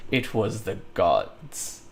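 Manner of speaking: low, soft, slow shrug of a line; deadpan excuse. Retold or imitated. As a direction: mumble the excuse innocently. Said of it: "And he just says".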